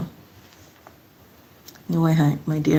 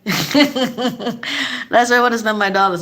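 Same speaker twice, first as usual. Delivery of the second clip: laughing; 5 semitones higher, slower than usual. Real, not acted. Laughing repeat.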